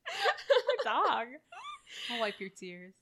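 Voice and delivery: high, loud, laughing fake outrage; laughing mock protective. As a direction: defend the dog, cracking up.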